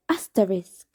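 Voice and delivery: ordinary delivery, nothing marked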